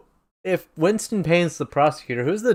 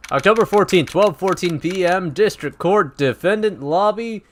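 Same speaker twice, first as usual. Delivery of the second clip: lilting announcer, drawn-out higher finish; scene caption announcement; 5 semitones higher than usual. Acted. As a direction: announce date and place, building to a stretched final number. Reads the scene caption aloud.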